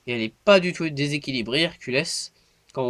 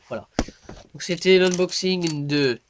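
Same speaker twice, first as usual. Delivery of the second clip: normal talking voice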